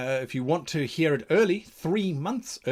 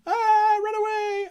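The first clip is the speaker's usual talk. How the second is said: very high, squealed comic terror; high-pitched pretend scream of alarm; mock panic; 5 dB louder than usual. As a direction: shriek it like a fleeing adventurer, hammed-up. Acted players fleeing the pack.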